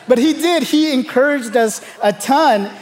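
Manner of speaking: ordinary talk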